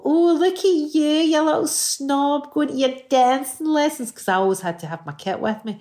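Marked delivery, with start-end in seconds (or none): 0.0-4.1 mocking bullies. People jeering at her going to dancing lessons, mocking. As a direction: mimic the sneering kids in a much higher voice. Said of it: "And people go".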